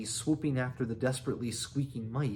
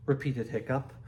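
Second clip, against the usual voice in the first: usual speaking voice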